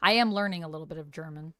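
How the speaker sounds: ordinary delivery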